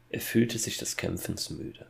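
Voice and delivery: plain reading voice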